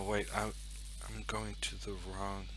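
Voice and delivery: ordinary delivery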